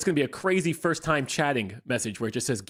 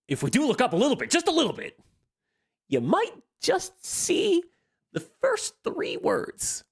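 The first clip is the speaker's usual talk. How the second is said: high teasing voice; 12 semitones higher than usual. Acted. Teasing chat to look up.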